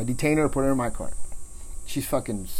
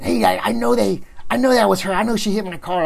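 higher-pitched imitation of indignant complaining; mildly heated retold protest; mad car owners; 6 semitones higher, 3 dB louder than usual. Car owners accusing the woman to the officer, mad. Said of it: "the owners of the vehicle get mad".

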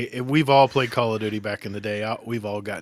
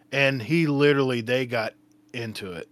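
ordinary talk, nothing marked

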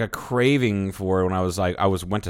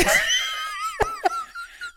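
high squealing laugh. Real, not acted. Laughing at own impression.

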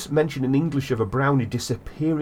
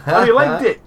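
laughing; 11 semitones higher, 9 dB louder than usual. Real, not acted. Laughing at the brownie voice.

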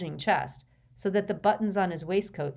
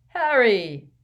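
grand, weighty greeting; portentous; 6 semitones higher, 10 dB louder than usual. A narrator's reading. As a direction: boom his name importantly. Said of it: "Ernie said portentously".

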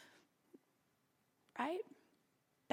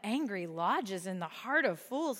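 slow, soft, mock-pious reading; a light mock-solemn quote, read slowly; mock solemn recital; 2 semitones lower, 4 dB quieter, slower than usual. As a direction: intone it with exaggerated solemnity. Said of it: "I'm like".

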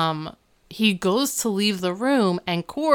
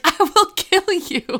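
hammed-up threat, much higher, louder and slow; mock death threat; 12 semitones higher, 5 dB louder, slower than usual. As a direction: overplay the furious protective dad. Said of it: "And Alan's just like".

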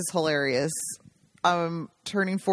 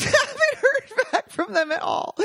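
laughing; 13 semitones higher, 3 dB louder than usual. Real, not acted. Laughing at the absurd delay.

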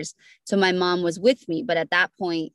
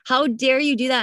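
indignant outrage; 7 semitones higher than usual. Retold or imitated. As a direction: throw it back at the doctor, stunned and furious. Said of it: "I was so angry at that doctor".

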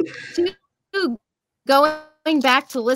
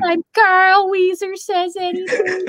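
Carl Wheezer; 9 semitones higher than usual. Acted. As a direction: do a stretched-out high cartoon impression. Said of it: "That, that Carl Wheezer voice is hilarious".

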